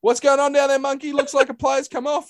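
high, excited TV football reporter; Chris Kamara impression. Mocking his co-host as a boundary rider, high excited host voice. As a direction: ham up the sideline host calling down. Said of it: "You're gonna be like Chris Kamara".

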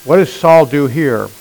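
normal talking voice

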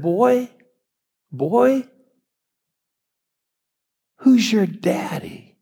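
high, slow mocking call of boy and daddy; taunting townsman. A man mocks a fatherless boy in town, high and taunting.

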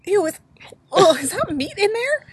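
high, loud grossed-out squeal; disgusted shriek. Real, not acted. Disgusted reaction to meat hot dog.

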